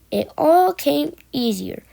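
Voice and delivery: ordinary delivery, nothing marked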